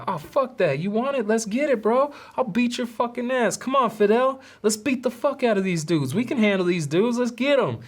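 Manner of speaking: imitating a hothead begging for a brawl; hyped aggression. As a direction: egg on the fight like a hothead. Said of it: "And Alex is like".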